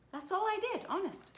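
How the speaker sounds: put-on very high, earnest child's protest; pleading innocence. A narrator's reading. Lulu swears to the nurse that's all, pleading. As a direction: insist squeakily that it's the truth.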